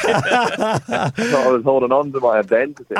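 laughing through the words, slow; chuckling while he speaks. Real, not acted. Laughing about his lost Scottish accent.

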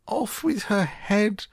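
very high, drawn-out royal shriek; furious queen's high shouted command; top-of-voice shout. A narrator's reading. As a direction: shriek the order slowly, far above normal pitch. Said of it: "the queen shouted at the top of her voice".

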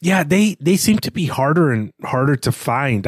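ordinary talk, nothing marked

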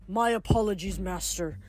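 softly, falsely sorry ghost king; insincere apology. A narrator's reading. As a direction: apologize without meaning it. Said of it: "didn't sound very sorry".

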